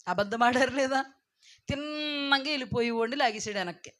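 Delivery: normal talking voice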